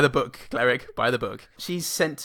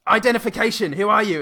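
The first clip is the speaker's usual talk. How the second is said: louder and higher barked demand; stern police demand; 8 semitones higher, 7 dB louder than usual. Retold or imitated. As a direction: challenge him like sense police. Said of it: "they say".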